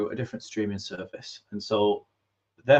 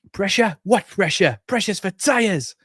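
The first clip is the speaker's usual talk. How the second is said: mock bravado; 10 semitones higher, 5 dB louder than usual. Shrugging off big-game pressure.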